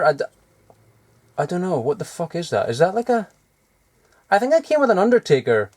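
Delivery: ordinary talk, nothing marked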